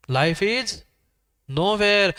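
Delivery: 'is' rising, a break, 'Nowhere' joined; nowhere as one word. Shown as a demonstration. Teacher repeating the board's words for effect, nowhere as one word. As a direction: say 'Nowhere' undivided after a pause. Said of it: "उसी वाक्य को समझदारी से पढ़ता".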